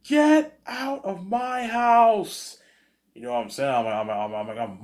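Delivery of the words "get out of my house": very high, slow, loud screech; parent yelling. Retold or imitated. Parents throwing the hacker son out, shrill yell. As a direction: drag out the scream at the son.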